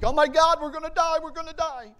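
high, loud, panicked cry of doom; panicked disciples. Retold or imitated. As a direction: scream it like the boat is going under. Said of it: "the disciples panic".

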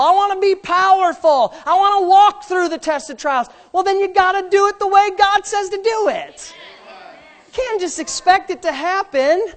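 high, insistent pulpit voice; full-force preaching. Acted. Preacher urging congregation to do it God's way, full force.

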